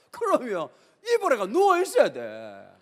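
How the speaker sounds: usual speaking voice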